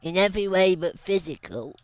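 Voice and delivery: smug cringe wolf impression; werewolf voice. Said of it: "Let me show you my wolf voice".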